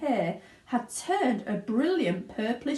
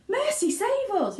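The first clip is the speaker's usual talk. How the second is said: very high, frightened cry; yelled; 14 semitones higher, 3 dB louder than usual. Acted. Mother yells in panic at the sight. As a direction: cry out to heaven. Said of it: "yelled Missus Beauregard".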